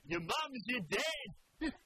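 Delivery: high, slow, yelled mock politician; John Howard. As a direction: yell it like a heckling prime minister. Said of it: "John Howard yelling out".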